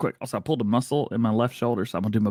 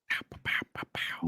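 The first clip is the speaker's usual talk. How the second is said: whispered pows; 12 dB quieter than usual. Pretend. Playful sound effects while clicking.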